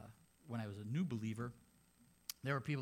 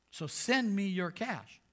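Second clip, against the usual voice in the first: slow, louder higher demand; mock money plea; 6 semitones higher, 8 dB louder, slower than usual. Preacher mocking a prosperity preacher's demand for cash, slow and loud. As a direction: drag out the greedy ask.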